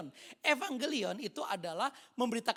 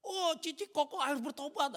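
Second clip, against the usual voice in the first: higher-pitched earnest appeal; urgent plea; 7 semitones higher, 5 dB louder than usual. Retold or imitated. Young convert to older siblings, urgent appeal. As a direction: re-enact an eager call to repent.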